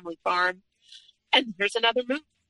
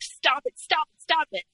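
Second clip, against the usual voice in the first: retold scream; 8 semitones higher than usual.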